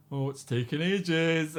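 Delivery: slow, drawn-out mock complaint; classic bar joke. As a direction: sigh it out like a weary bar queue. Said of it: "there's the classic joke being like".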